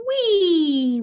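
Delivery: "wee" high, loud and stretched; word demonstration. Shown as a demonstration. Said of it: "Say the word".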